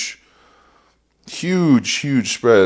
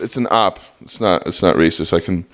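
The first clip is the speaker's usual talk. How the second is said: ordinary talk, nothing marked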